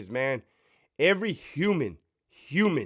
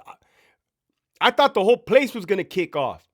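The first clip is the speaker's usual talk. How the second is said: ordinary talk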